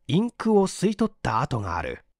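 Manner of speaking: ordinary delivery, nothing marked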